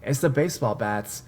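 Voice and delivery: ordinary delivery, nothing marked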